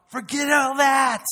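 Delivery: shouting it out, slow; defiant shout. Acted. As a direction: shove it all aside. Voicing determined worshipper.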